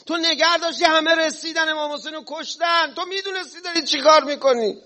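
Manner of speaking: high-pitched, wailing accusation; mourner's high keening reproach; accusing wail. Acted. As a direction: cry out the blame at him. Cursing Hurr as others would.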